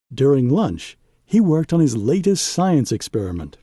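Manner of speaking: ordinary delivery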